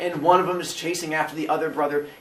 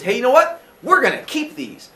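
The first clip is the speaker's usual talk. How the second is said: high, louder, brightly decided; eager decision; 8 semitones higher, 5 dB louder than usual. Acted. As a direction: announce it like a proud dad. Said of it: "he goes".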